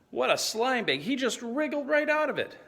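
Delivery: high-pitched, disgusted exclamation; incredulous outrage. Acted. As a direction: exclaim high in disbelief at his slipperiness. Outraged at rival dodging the question.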